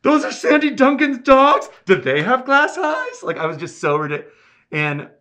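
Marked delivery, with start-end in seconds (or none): 0.0-3.2 very high, loud mock amazement; starstruck shriek. Learning the dogs were Sandy Duncan's, starstruck shriek. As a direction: squeal in hammed-up fan disbelief.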